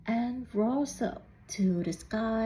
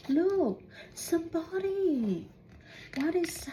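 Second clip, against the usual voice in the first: higher, excited look-at-that; excited pointing; 7 semitones higher than usual. A narrator's reading. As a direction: call the kids to look. Narrator points at new creature.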